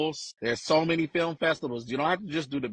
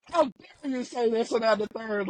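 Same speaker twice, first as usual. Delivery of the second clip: indignant; 7 semitones higher than usual. Retold or imitated. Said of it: "I was like".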